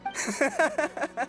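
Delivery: high, giggly laugh; delighted laugh. Acted. Warrior delighted after sparring with the hero, giggling. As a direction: laugh happily in a high pitch.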